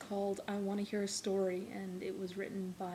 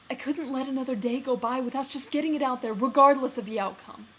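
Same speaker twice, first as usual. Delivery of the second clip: louder, higher outburst of pent-up love; impassioned confession; 4 semitones higher, 10 dB louder than usual. Acted. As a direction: blurt it out loudly, as if unable to hold back. Acted confession of love in monologue.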